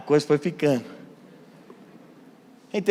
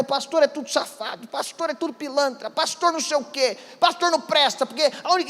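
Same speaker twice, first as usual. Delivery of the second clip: retold yelling; 10 semitones higher than usual. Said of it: "começou a gritar".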